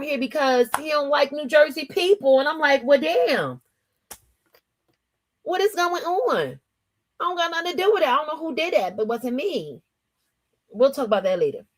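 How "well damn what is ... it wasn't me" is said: higher-pitched, baffled protest; mock innocent protest. Streamer answering her hater down South, mock innocent. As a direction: plead innocence in a high, playful voice.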